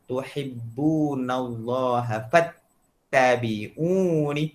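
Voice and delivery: "Tuhibbunallaha fattabi'uni" recited very slowly, every letter placed clearly; slow the verse right down to show the tongue; slow recitation. Shown as a demonstration. Teacher demonstrates the ta sound in a verse, slow. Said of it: "Dekat sini".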